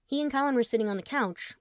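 ordinary delivery

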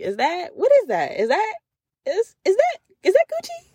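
building from curious to a delighted squeal; amazed. Acted. Spotting heavenly designer clothes, rising into a squeal.

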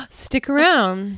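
drawn-out playful tease; teasing. Real, not acted. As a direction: stretch it slowly, luring them in. Podcast sign-off tease.

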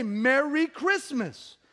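MERRY CHRISTMAS high and drawn out slowly; emphatic greeting. Retold or imitated. Preacher insisting on his greeting, slow and emphatic "Merry Christmas".

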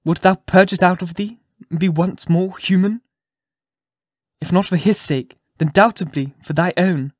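earnest plea. A narrator's reading. Hester pleads for forgiveness.

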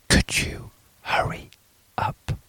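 urgent unvoiced whisper. A narrator's reading. Priest whispering to the visitor in the confessional. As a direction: hurry him in a hushed breath. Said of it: "The priest whispered to him".

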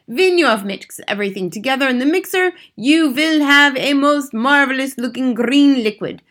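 lightly voiced commanding witch; theatrical witch giving orders; imperious head witch. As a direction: dictate a wicked recipe with emphatic relish. Grand High Witch instructs and warns.

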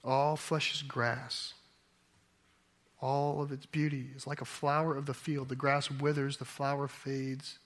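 quiet, measured verse reading; solemn scripture reading. Acted. Preacher reads Isaiah to the congregation, solemn scripture reading. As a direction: read the verse softly and evenly, letting it settle.

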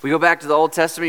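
ordinary talk

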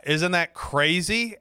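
retold pointed remark; pointed mock disbelief. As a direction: draw it out with a knowing hint. Pointed reply hinting at the others.

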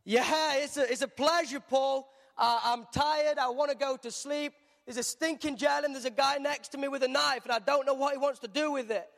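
tired grumbling sarcasm; grumpy cellmate moaning in a high voice; sarcastic Silas. Retold or imitated. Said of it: "Silas is like".

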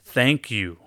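loud, declared thank you; loud grateful affirmation. Shown as a demonstration. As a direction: proclaim your thanks. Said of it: "Louder and louder".

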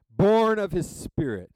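loud, high proclamation; booming, exclamatory reading; emphatic exclamation. Shown as a demonstration. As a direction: belt out the hymn line as a declaration. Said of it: "I'm an exclamation point kind of guy".

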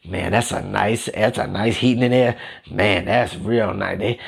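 admiring house guest. Acted. Imagined guest admiring the attic heating system, mock-impressed and low. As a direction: drop way down to marvel like a visitor. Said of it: "They don't come in and say".

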